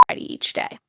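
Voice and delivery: softly whispered, no voice. Shown as a demonstration. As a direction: breathe the words out as a secret. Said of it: "Okay. Get ready".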